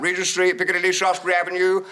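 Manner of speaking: loud conductor's call; calling out stops. Retold or imitated. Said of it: "he'd called out".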